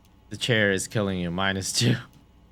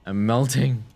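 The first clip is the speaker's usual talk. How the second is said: louder, hammed-up whine; mock melodrama; 4 semitones higher, 6 dB louder than usual. Player voices the detective melting into the chair, hammed-up. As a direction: ham up the wilting. Voicing dramatic character line.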